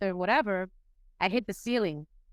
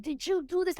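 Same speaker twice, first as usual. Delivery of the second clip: boss asking; 9 semitones higher than usual. Retold or imitated. Boss voiced in story.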